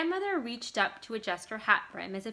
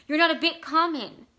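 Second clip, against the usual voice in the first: higher, louder pleading; desperate plea; 7 semitones higher, 9 dB louder than usual. A narrator's reading. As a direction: beg him to believe it. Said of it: "she said desperately".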